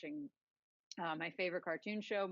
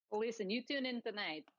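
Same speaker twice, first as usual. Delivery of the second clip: normal talking voice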